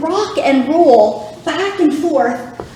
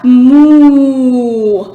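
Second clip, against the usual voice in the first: loud, lower moo; low the way a cow does for the kids; 3 semitones lower, 5 dB louder than usual. Shown as a demonstration. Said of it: "And back to cow".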